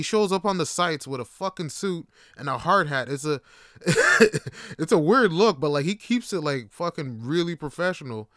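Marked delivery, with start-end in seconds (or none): 3.8-4.7 high, loud burst of laughter; real laugh at his own story; amused laugh. Real, not acted. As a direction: crack up mid-sentence.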